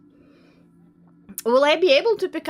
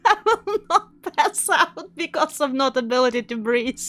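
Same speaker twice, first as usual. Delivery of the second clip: ordinary delivery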